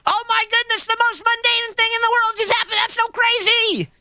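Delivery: very high, hammed-up squeal; sky-high parody of a hyped streamer; over-the-top excitement. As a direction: overreact wildly to something trivial. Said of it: "And here's me".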